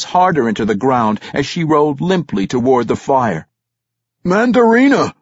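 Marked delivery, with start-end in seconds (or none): 4.2-5.1 high, loud cry for help; name yelled out, falling at the end; shouted call. A narrator's reading. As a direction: holler for the healer. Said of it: "Ragged Dane shouted for Mandarina".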